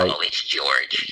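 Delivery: low, slow mock phone greeting; a Lancashire man's take on George; impression of George Wilkinson. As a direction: drop low and drawl it out like George. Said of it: "Steve Barclay doing an impression of George Wilkinson".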